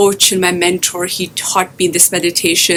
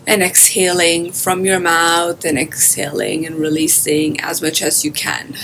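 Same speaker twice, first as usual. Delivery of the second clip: guided meditation. Acted. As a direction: guide listeners gently into their breath, drawing out phrase endings.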